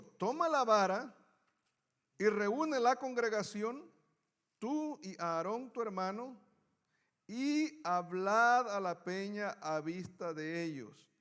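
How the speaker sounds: loud, slow, weighty reading of God's words; solemn scripture reading. Acted. Preacher reading God's order to Moses, slow and loud. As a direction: proclaim it slowly, like a command from above.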